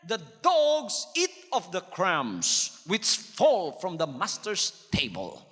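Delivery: very slow solemn verse; slow scripture reading. Acted. Preacher reading the woman's answer, very slow. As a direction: draw each word out.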